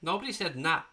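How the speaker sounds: ordinary talk, nothing marked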